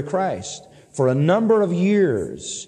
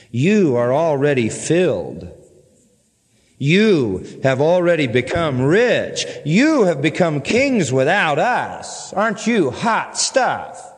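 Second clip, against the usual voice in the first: slow, drawn-out dripping sarcasm; sarcastic; slower than usual. Acted. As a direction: lay the mock admiration on slowly. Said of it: "Get the sarcasm".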